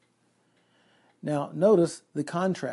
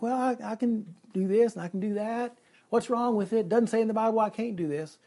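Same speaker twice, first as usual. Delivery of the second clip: higher-pitched mock excuse-making; self-justifying; 6 semitones higher than usual. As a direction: imitate people arguing their way out of it. Voicing people's self-justifying thoughts.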